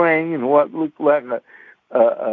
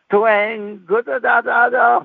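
higher, drawn-out twang and da-da plucks; banjo twang; 7 semitones higher than usual. Retold or imitated. Boy kneeling and strumming his proposal, mock banjo. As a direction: pluck it out slowly with your voice.

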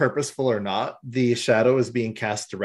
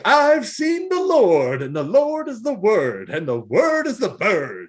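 mock preacher; 8 semitones higher, 4 dB louder than usual. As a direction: proclaim it like a raving televangelist. Corrupted TV raving like a preacher.

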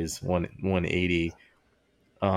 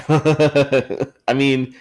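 ordinary talk

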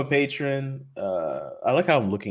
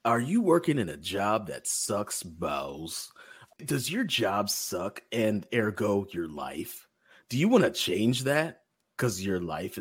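slow, drawn-out infomercial pitch; slower than usual. Acted. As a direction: sell it slowly like a late-night ad. Mock ad pitch for the Slack.

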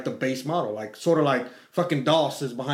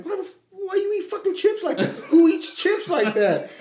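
high-pitched exasperated outburst; mock outrage, voice shooting way up; retold anger. Dad recalling getting mad at his daughter over chips, high exasperated outrage. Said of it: "I get mad at her".

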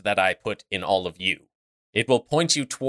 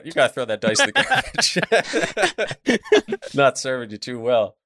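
laughing; 3 semitones higher than usual. Real, not acted. GM laughing at roll.

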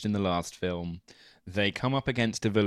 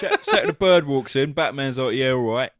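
a lightly put-on London builder voice, a bit louder; 6 dB louder than usual. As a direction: play a cheeky London builder over his tea. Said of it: "acting as if a builder would".

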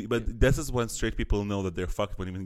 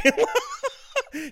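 high-pitched laugh; 5 dB louder than usual. Real, not acted.

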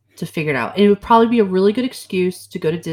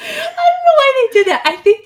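laughing exasperation; 3 dB louder than usual. Real, not acted. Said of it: "laugh cry".

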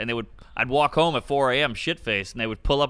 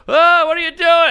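much higher, louder startled cry; startled waking; 9 dB louder than usual. Retold or imitated. Comic retelling waking to muggers, much higher and louder startled cry. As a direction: jolt awake in alarm. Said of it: "And I go".

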